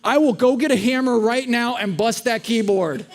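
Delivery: slow, emphatic mock yell; reenacted furious yell. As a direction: bellow an empty threat like a fed-up parent. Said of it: "yelling. The neighbors probably heard it".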